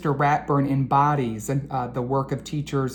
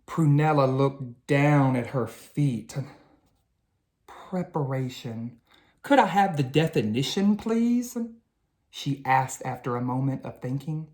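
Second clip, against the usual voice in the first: lively, expressive storybook reading; animated children's book narration; 4 semitones higher than usual. A narrator's reading. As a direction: read it like bedtime story time for kids.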